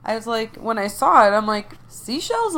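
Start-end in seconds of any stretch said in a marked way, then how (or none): 1.9-2.5 high, questioning squeak of surprise; surprised. Retold or imitated. Recalling finding seashells at the ocean, surprised. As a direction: retell it with pitch leaping way up. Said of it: "like, surprised".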